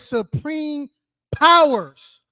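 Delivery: "supreme powers" drawn out slowly, with "powers" punched loud; stress on supreme powers. Real, not acted. Preacher naming what we wrestle with, "supreme powers" slow and emphatic. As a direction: set "powers" apart after a pause and hit it hard. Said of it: "I wanna emphasize that word supreme power".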